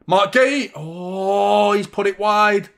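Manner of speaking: high, loud groan at the miss; gutted it went wide; excited groan. Real, not acted. Fan groaning as his team misses. Watch-along missed chance.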